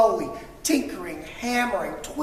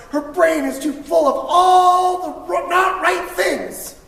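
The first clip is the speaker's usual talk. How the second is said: higher and louder, strained; frustrated outburst; 8 semitones higher, 7 dB louder than usual. A narrator's reading. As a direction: let the frustration spill out. Narration of her frustration.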